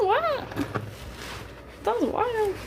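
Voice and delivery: real shock at the number; surprised what. Real, not acted. Reacts to follower count.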